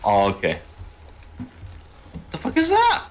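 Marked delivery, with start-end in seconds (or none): 2.3-3.0 startled. Real, not acted. Strange noise in game.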